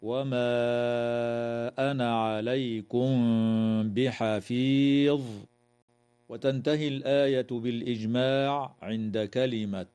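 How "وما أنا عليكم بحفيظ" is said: ميم of عليكم hidden before بحفيظ with a nasal hum; hidden meem. Shown as a demonstration. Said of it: "تخفى الميم الساكنة عند الباء مع الغنة".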